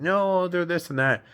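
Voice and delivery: high mock protest on a friend's behalf; defensive friend. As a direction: jump up high, imitating a protest. Voicing women defending their friend.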